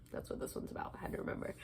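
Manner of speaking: whispered reminder to herself; muttered aside. Real, not acted. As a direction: drop to a breathy whisper as if thinking aloud.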